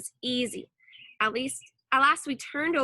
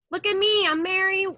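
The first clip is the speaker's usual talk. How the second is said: high loud holler, bravado over nerves; loud, high brave-sounding holler; holler, trying to sound brave; 8 semitones higher, 4 dB louder than usual. A narrator's reading. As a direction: call out, faking courage. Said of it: "I hollered, trying to sound brave".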